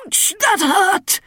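high startled yelp from the grumpy king; king's yelp of pain. A narrator's reading. King jabbed by his daughter, high pained yelp. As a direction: jump up yelping like a pricked king.

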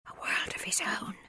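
put-on soft whispered child's echo; whispering. A narrator's reading. Shy Bert echoes his sister, whispering. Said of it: "whispered Bert".

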